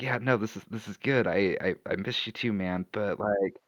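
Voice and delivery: low, soft in-character voice; hushed, low-pitched reassurance to a friend; hug murmur. Acted. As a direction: speak deep and quiet, as if into a hug. Acts out character's words during hug.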